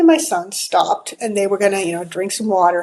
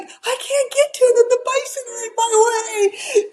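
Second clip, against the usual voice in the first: much higher, tearful panic; panicked son; 14 semitones higher than usual. Retold or imitated. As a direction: cry out, scared and stuck. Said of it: "And he's like".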